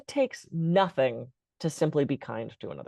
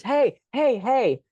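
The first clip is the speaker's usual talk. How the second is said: calling out; 6 semitones higher, much slower than usual. Retold or imitated. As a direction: call after her to stop her. Man calling after her outside.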